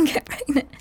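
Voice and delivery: ordinary talk, nothing marked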